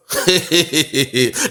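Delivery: drawn-out chuckling cackle; mocking laugh. Real, not acted. Commentator scoffing at the video, mocking laugh. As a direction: laugh at length, a little high.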